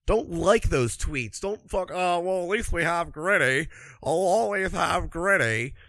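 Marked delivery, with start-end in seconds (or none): 1.9-5.7 mock consoled fan. Mocking a resigned fan.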